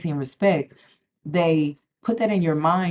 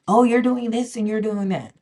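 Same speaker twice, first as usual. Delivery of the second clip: higher-pitched mimicking of critics; mock accusing quote, pitched up; mimicked accusation; 5 semitones higher than usual. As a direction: imitate a nagging accuser in a higher voice. Mimicking people who criticize her.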